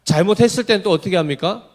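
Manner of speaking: ordinary delivery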